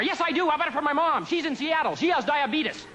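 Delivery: high, jittery over-eager reply to the cop; nervous over-answering. Retold or imitated. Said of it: "You over-answer 'cause you're shook up".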